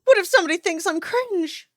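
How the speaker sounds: scolding herself for fearing cringe; mock anxious whine. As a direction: whimper it, mocking yourself. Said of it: "and then you're like".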